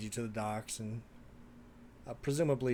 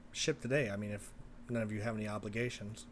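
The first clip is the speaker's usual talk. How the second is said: normal talking voice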